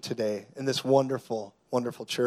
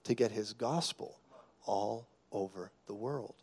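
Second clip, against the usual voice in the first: intimate hush; 6 dB quieter than usual. Real, not acted. Preacher drops into intimate hush.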